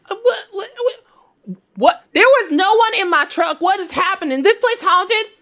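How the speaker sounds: high, rattled, stammering what; spooked panic. Acted. Trucker character spooked by phantom in truck, panicky high. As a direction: panic rising in an empty truck.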